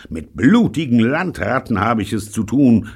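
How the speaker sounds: high, loud scolding yell; shouting. Acted. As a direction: yell at the landlubbers. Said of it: "schrie er dann". (laut schreiend)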